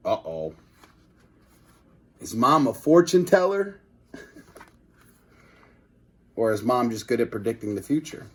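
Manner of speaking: usual speaking voice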